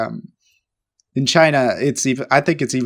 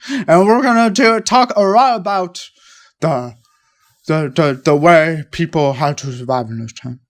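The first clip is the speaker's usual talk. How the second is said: imitated lecturer, dropping from raised to mumbled; teacher trailing off. Teacher lecturing on survival, trailing off gruffly. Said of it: "You would talk like that".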